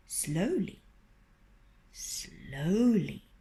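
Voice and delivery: drawn-out slowly. A narrator's reading. Fox creeping closer.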